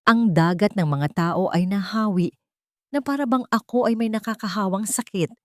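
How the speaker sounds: ordinary delivery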